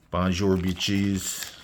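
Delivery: put-on slow, drawn-out French accent greeting; French accent to soften a rude greeting. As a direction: say it like a cheeky Frenchman. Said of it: "Gotta say that with a French accent".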